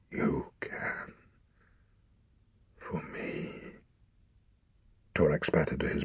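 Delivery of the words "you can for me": barely voiced, breaking whisper; dying father begs his son softly; faint whisper. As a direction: plead with your last breath. Dying father's last plea.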